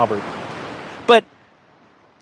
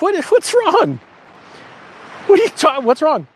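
much higher, startled and worried; imitating the jolt of seeing mom cry; retold alarmed question; 8 semitones higher than usual. Son asks his crying mom what happened, high and alarmed.

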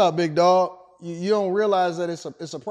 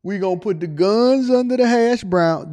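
punchier, louder repeat; 8 dB louder than usual. Acted. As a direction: belt it out louder.

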